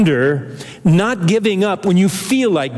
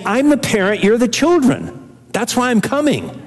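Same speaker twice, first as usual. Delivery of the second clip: voicing Paul; 6 semitones higher than usual. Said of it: "Paul's saying".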